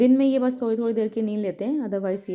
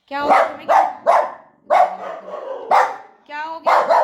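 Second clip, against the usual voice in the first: loud raised calling out; 6 semitones higher, 8 dB louder than usual. Real, not acted. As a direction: call out sharply across the room. Mother calling to barking dog.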